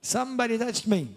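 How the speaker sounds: high startled cry; startled exclamation. Pretend. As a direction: exclaim it in sudden surprise. Interpreter echoes Jesus startled.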